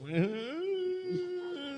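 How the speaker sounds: high, soft whimper; long high whine on the brink of tears; mock near-crying whine. Storyteller imitates his upset drinking friend, high tearful whine. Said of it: "he's about to cry".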